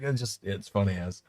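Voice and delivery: ordinary talk, nothing marked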